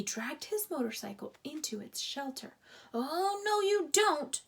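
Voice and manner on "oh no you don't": high, loud dog's threat; growled threat. A narrator's reading. Dog threatens the hiding mouse, loud high growl. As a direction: snarl it as you dig. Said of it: "growled Sam".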